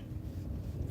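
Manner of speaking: sharp, quiet intake of breath; excited gasp. Real, not acted. Driver spotting a ski shop, excited gasp. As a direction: gasp at a delightful find.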